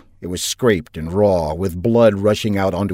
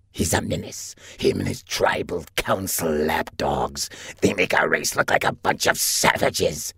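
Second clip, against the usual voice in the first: reptile officer; 4 semitones lower, 4 dB quieter than usual. A narrator's reading. Lizard officer scorning the pirate, soft hissing reptile voice. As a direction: breathe it like a cold reptile.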